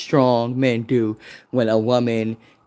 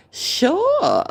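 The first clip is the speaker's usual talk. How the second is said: much higher, bright sure; high amused sure. Retold or imitated. Driver answering her tease, high amused sure. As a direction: toss off a high chuckling sure. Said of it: "He was like".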